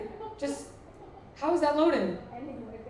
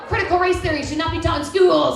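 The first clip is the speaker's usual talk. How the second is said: loud, pitched-up conservative outburst; mock Republican; 6 semitones higher, 8 dB louder than usual. Comic quoting her inner Republican, loud and high. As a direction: rant like an angry Republican.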